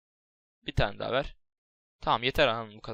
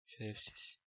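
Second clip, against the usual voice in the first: whispered curse; 4 semitones lower, 17 dB quieter, slower than usual. Real, not acted. Muttered curse after attack.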